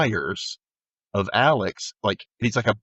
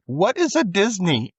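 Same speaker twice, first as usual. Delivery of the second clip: lightly voiced confused Ghost Rider, higher and slow; high, slow puzzled Ghost Rider; 9 semitones higher, slower than usual. Retelling Ghost Rider to a friend, mock puzzled high voice. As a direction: ask it like a baffled stranger to cartoons.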